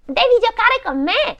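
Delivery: put-on high, loud child's demand; demanding little girl. A narrator's reading. Brigand's daughter ordering Gerda to play, demanding. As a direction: insist like a pushy brat. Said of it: "disse la figlia del brigante".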